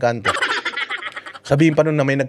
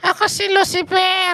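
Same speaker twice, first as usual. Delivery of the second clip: much higher, otherworldly spirit voice; possessed spirit; 14 semitones higher, slower than usual. Retold or imitated. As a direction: speak as the spirit inside the possessed person. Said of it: "Sino ka?".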